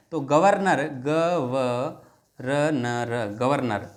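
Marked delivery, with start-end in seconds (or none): 0.8-3.3 ग व र न र very slow, "ga, va, ra, na, ra"; letter by letter. Shown as a demonstration. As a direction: stretch and separate each sound of ग व र न र. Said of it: "इस प्रकार से उच्चारण है इसका". (ग व र न र, एक-एक अक्षर धीरे-धीरे अलग)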